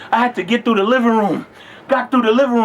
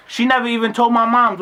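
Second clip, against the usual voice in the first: normal talking voice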